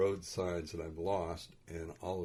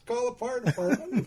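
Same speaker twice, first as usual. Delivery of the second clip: higher, firm mock-insistent advice; 7 dB louder than usual. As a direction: lay it out as if it's obvious. Dad insists, first time.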